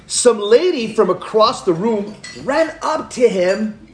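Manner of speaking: normal talking voice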